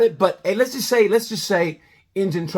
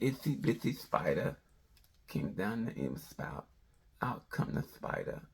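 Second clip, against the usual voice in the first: low, soft, slow bedtime reading; Tyson bedtime story; 7 semitones lower, 15 dB quieter, slower than usual. Retold or imitated. Boxer reading a bedtime story, very soft and slow. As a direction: Mike Tyson softly reading to a child. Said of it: "Mike Tyson read a bedtime story".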